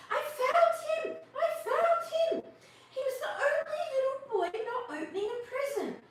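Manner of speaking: very high, loud, breathless with laughter; high squeaky excited bird voice; excited sparrow. A narrator's reading. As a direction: burst in shouting the good news. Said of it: "came bursting through the branches".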